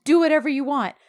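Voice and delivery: loud, impassioned mock retort; hurt outburst. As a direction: act out a wounded lover lashing out. Acted quarrel, her hurt outburst.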